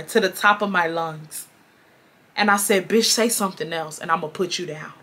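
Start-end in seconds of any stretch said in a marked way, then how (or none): 2.9-4.9 low, soft threat; retold threat without the yelling; calm voice. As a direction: keep it low and calm, saving energy. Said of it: "I'm just saying it in a calm voice".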